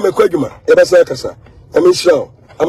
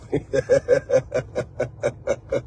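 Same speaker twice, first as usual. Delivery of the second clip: long, low, quiet chuckle; steady string of soft chuckles; chuckling; 6 semitones lower, 11 dB quieter than usual. Real, not acted. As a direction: keep a soft low laugh rolling. Amused during own live video.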